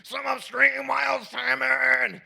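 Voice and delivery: high shouted self cheer; mock pep talk to yourself; hype cheer. Cheering himself on while dancing.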